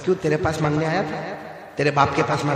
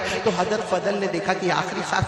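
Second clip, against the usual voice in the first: high, loud sermon build-up with laughter; impassioned preacher pitch; fiery preaching; 8 semitones higher, 4 dB louder than usual. Acted. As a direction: rouse the crowd, rising to a cry. Preaching at full force.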